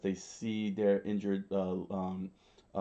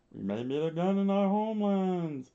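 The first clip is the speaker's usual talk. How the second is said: flashback voice; 9 semitones higher than usual. A narrator's reading. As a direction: float it out high like a memory. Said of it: "in their flashback".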